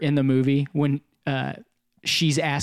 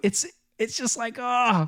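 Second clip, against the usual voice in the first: mock distressed wail; 7 semitones higher, 4 dB quieter, slower than usual. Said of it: "I'm like".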